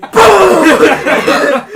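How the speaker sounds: loud, high imitated impact; shouted crash noise. Storyteller imitating a slam into tires, loud shout. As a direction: boom it out like an explosion.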